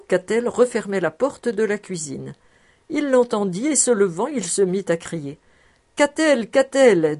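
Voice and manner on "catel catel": louder, higher call for help; panicked calling out, louder and higher; desperate call. A narrator's reading. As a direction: yell like a drowning man. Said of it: "d'une voix d'homme qui se noie".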